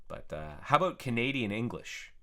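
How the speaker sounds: ordinary talk, nothing marked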